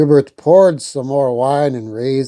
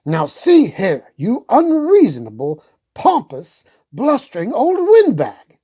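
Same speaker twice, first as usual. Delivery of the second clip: mild mock fury building up high; furious; 8 semitones higher than usual. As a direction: scold him, rising as you go. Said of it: "King Stefan was furious".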